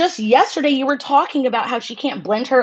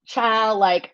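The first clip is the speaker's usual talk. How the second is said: usual speaking voice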